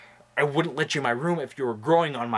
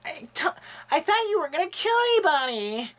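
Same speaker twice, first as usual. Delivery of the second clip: very high, girlish mock voice; mocking little John Connor; 12 semitones higher than usual. Vlogger mimicking young John Connor's odd voice. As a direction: squeak it like a boy's breaking voice. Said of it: "He talks really weird".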